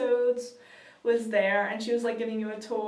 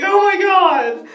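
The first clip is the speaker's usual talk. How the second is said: loud, high, drawn-out laughing exclamation; overwhelmed fan; 8 semitones higher, 13 dB louder, slower than usual. Retold or imitated. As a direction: cry it out, stretched and giddy. Fan reaction versus calm family.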